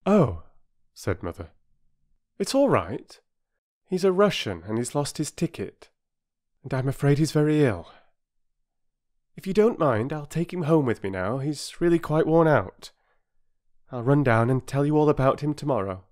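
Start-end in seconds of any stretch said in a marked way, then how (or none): 0.0-0.4 high, soothing mother's voice; mother calming the station master; reassuring mother. Acted. 2.4-16.0 high, soothing mother's voice; mother calming the station master; reassuring mother. Acted.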